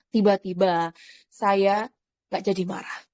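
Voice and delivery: ordinary talk, nothing marked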